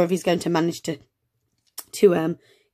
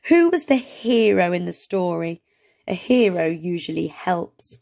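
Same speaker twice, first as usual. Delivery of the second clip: ordinary talk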